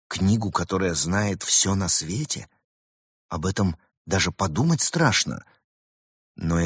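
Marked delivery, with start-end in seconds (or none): none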